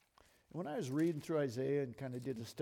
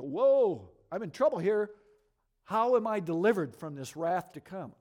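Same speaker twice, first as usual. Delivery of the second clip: loud, higher-pitched alarmed outburst; acted alarm; 5 semitones higher, 10 dB louder than usual. Preacher acts out a hearer fearing coming wrath, loud alarm. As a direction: cry out as if suddenly in trouble.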